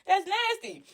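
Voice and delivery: put-on high squealing child voices; grossed-out kids. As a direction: say it like disgusted little kids. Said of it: "The kids was like".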